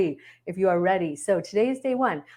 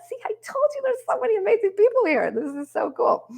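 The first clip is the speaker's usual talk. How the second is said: excited high squeal. Real, not acted. Excited about viewers joining.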